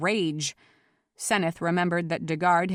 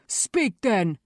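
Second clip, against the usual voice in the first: growled order; 3 semitones higher, 3 dB louder than usual. A narrator's reading. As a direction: growl it right into someone's face. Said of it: "grabbed a fold of Seneth's shirt and growled".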